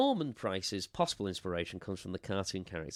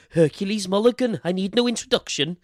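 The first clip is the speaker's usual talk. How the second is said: put-on loud, high, hammy Welsh accent; terrible Welsh accent; 10 semitones higher, 10 dB louder than usual. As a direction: belt it out in a bad Welsh accent. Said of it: "sorry for the terrible Welsh accent".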